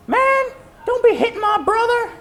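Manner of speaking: very high, loud mock kid yell; kids going crazy. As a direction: imitate outraged kids in a squeaky, high-pitched shout. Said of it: "they go crazy. Like, they're all like".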